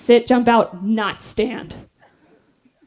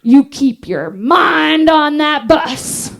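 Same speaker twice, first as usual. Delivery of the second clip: self pep talk; much slower than usual. Retold or imitated. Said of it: "And so I say to myself".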